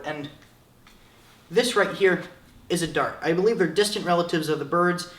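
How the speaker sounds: ordinary delivery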